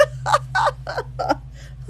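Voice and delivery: squeaky helpless giggle; can't hold in a high wheezing laugh; breathless high-pitched cackle. Real, not acted. Cracks up at the book.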